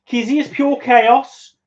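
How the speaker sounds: slow, high put-on Scouse; hammed-up Liverpool accent. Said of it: "Try, try doing my next thing in it".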